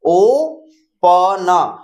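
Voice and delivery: ओपन with the ओ drawn out very slowly; long held o. Shown as a demonstration. Teacher holding the long o of open. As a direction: hold the ओ of ओपन for two seconds. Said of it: "दो second तक hold करना है".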